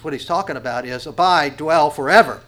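usual speaking voice

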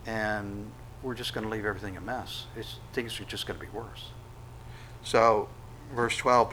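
ordinary talk

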